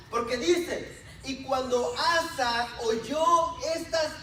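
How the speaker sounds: emphatic sermon delivery; forceful preaching. Real, not acted. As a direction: declaim the scripture to the congregation. Preaches and reads scripture forcefully.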